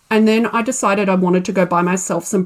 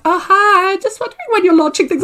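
much higher, fast, breezy customer voice; chirpy customer; 10 semitones higher, 4 dB louder than usual. Retold or imitated. Instagram follower asking about the launch, fast, much higher and breezy. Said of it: "another person on Instagram's like".

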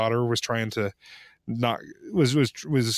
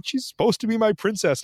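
imitating wife; 10 semitones higher than usual. Wife's complaint voiced.

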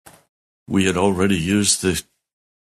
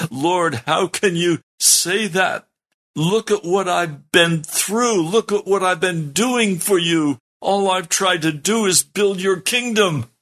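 high, slow, indignant protest at God; retold angry outburst; 10 semitones higher, slower than usual. Pastor retelling his angry protest to God, high indignant complaint. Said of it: "I just exploded with anger".

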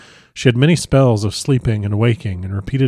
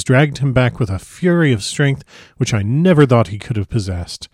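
plain reading voice

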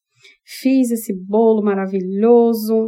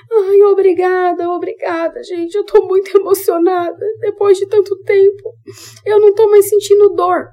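high, tearful, sobbing thanks; overwhelmed crying gratitude, voice pitched far up; 10 semitones higher, 5 dB louder than usual. Retold or imitated. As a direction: relive the crying as she thanks them, much higher. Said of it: "Eu comecei a chorar".

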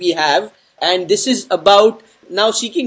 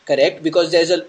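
ordinary talk

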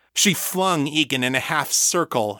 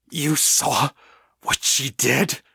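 strangled with pain; 3 semitones higher, slower than usual. A narrator's reading. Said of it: "Victor's voice sounded half strangled with pain".

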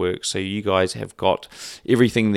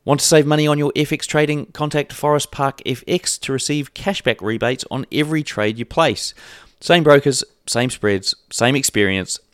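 upbeat sponsor read, a bit higher and punchier; 3 semitones higher, 3 dB louder than usual. Acted. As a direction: sell it like a radio spot.

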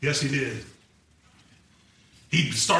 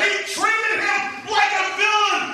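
preaching at full force; 12 semitones higher, 5 dB louder than usual. Acted. Preacher thundering to the congregation, at full force. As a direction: drive each phrase home from the pulpit.

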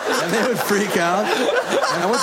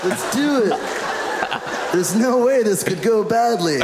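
put-on drunk brain; 3 semitones higher than usual. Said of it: "old booze brain was like".

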